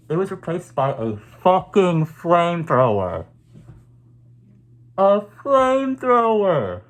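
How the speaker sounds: builds from loud disbelief to a high, slow yelled flamethrower; outraged shout. Real, not acted. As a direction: yell it like you can't believe it. Outraged fan ranting about weapon.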